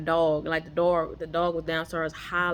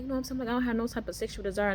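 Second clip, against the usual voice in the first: high, quick excited report; 6 semitones higher, much faster than usual. Retold or imitated. Speaker recounts telling him the news, excited. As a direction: retell it eagerly in a raised, hurried pitch. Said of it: "I'm, I'm excited".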